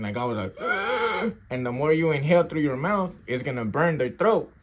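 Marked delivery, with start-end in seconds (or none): 0.6-1.4 high, strained gasp for air; imitated choking gasp. Man recounting being sprayed, imitated choking gasp. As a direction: act out choking on pepper spray. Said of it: "I felt like I was choking".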